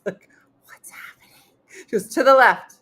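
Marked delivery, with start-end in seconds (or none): 0.7-1.4 hushed, confused whisper. Retold or imitated. Confused aside during the exercise class. 2.1-2.8 higher, louder, upbeat instructor calls; rally a room of seniors in wheelchairs; peppy exercise leader. Retold or imitated.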